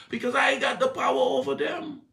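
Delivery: high, loud preaching with a shout; full-force preaching. Acted. Preacher telling the church Jesus has power, preached full force.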